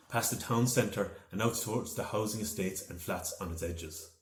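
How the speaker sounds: ordinary delivery, nothing marked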